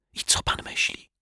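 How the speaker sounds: hushed, secretive question; whisper. A narrator's reading. Detective asks Mr Mietek's opinion, whispering. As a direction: keep it to a breath of sound. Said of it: "szepnął".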